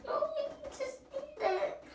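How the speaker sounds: laughing. Real, not acted. Laughing in ice bath.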